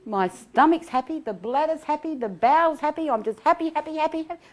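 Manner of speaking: high, bubbly, half-laughing glee; giddy mock delight. Mock inner voice delighting in happiness.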